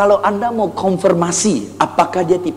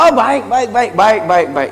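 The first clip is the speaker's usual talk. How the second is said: upbeat driver type; 3 semitones higher than usual. Retold or imitated. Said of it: "Dia akan bilang".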